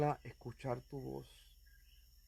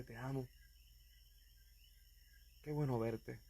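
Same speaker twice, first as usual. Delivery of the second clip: softer, tender father's greeting; 8 dB quieter than usual. Retold or imitated. Father welcoming his son home, softly tender. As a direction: hush it like a dad holding his child.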